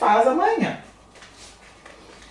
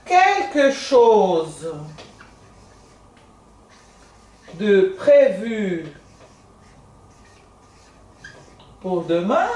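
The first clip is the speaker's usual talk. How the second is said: slow, spaced French model phrase; 3 semitones lower, much slower than usual. Shown as a demonstration. Teacher slowly models French question for students. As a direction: say each chunk slowly for students to repeat. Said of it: "o nosso tu as aqui de novo".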